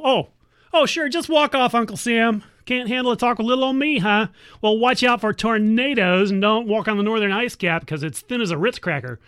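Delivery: ordinary delivery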